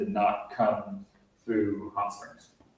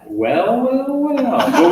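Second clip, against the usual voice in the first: drawn-out, swooping high well-well-well; loud, teasing rise and fall; playful exclamation; 12 semitones higher, 7 dB louder, much slower than usual. Real, not acted. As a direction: pitch leaps way up, then drops. Playful reaction to visitors' answer.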